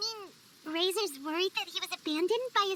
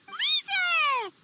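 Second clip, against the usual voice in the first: high, loud call across a distance; excited, loud call to a friend; 9 semitones higher, 6 dB louder than usual. Acted. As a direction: shout his name to catch his attention. Paimon spots Razor from afar.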